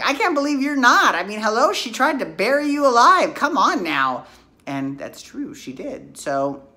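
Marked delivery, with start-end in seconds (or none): 0.1-4.2 higher, louder gleeful retort, still imitating; gleeful Victor. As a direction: Victor gloats that she deserved it. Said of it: "he's like".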